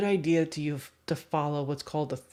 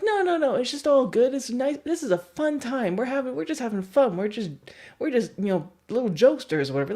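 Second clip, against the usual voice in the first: high, breezy mock reassurance that speeds up at the end; oblivious joker; 8 semitones higher, 5 dB louder than usual. Head-patter dismissing the guest's complaint, high breezy mock cheer. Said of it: "But they're like".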